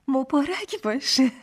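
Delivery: high, loud cheer ending in laughter; delighted congratulations. A narrator's reading. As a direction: congratulate happily, then laugh. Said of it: "خوشحال شدم". (با خوشحالی و خنده)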